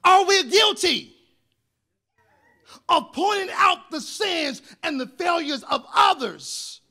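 loud, rising sermon preaching; preaching at full force. Acted. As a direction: preach it out to the congregation, loud and slow.

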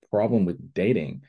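ordinary delivery

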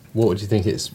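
usual speaking voice

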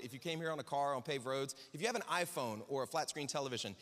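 ordinary talk

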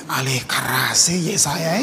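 low, slow, soft divine summons; voice of God. Acted. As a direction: play God, drawn out and deep. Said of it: "Tuhan bilang gini". (suara Tuhan, rendah dan pelan)

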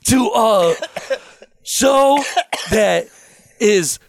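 bursting into a loud shout; sudden shout. Acted. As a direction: yell the last words out of nowhere. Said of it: "That caught me off guard".

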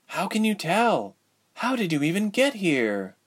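put-on young woman's voice. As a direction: plead in bitter frustration. Ilse's voice.

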